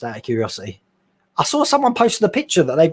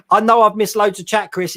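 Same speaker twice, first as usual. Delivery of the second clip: ordinary talk, nothing marked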